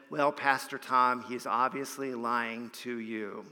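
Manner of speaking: understated quote, dropping low at the end; deadpan retold reply. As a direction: deliver the retort quietly and deliberately. Retold punchline of the woman's reply.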